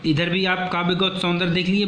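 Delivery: normal talking voice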